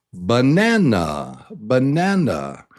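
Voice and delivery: banana, melodic peak in the middle; stress on middle syllable. Shown as a demonstration. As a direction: lift NAN high in banana, then fall. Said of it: "the tune or the song of the word".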